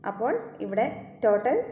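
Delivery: ordinary talk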